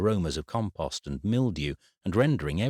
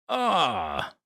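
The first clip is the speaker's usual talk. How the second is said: pained shout; 8 semitones higher than usual. Acted. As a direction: shout as the muscle throbs. Throbbing pulled muscle.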